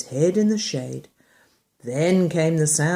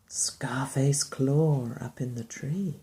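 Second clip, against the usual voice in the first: whispering; 6 semitones lower, 8 dB quieter, slower than usual. Real, not acted. Whisper to child.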